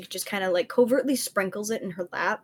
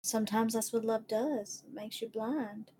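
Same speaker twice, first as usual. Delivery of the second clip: softer, gentle consoling friend; soft comfort; 8 dB quieter than usual. Acted. Stranger soothing a crying woman at the bar, soft. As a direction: soothe her quietly. Player's character comforting softly.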